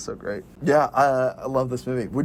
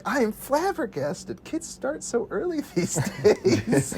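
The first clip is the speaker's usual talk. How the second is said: high, slow, flabbergasted impression; hammed-up shocked quote; mock astonishment; 7 semitones higher, 3 dB quieter, slower than usual. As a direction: draw it out in high-pitched disbelief, laughter creeping in. Acts Will Forte's shocked line.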